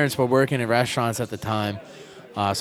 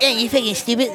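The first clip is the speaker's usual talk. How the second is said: high-pitched inner-heckler impression; Christopher Titus's little voice; 10 semitones higher than usual. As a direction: jump up high into a little mocking voice. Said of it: "that little voice he does".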